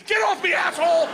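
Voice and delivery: high, loud furious yell; panicked cameraman yelling; angry shout. Retold or imitated. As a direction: kick him away yelling. Cameraman kicking him off.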